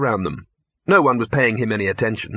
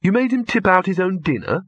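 high-pitched horrified question; appalled disbelief, acted; 8 semitones higher than usual. A narrator's reading. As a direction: react aghast, voice jumping up.

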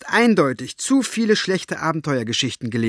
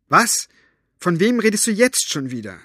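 impatient; 7 semitones higher, 3 dB louder than usual. Acted. Elinor startled and impatient.

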